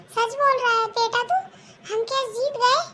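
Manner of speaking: high, excited disbelief with a tearful catch; can it be true, almost crying with joy; mother stunned to hear they won the case; surprised joy. Acted.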